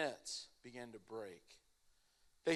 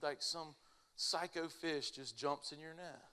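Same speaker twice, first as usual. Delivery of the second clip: ordinary delivery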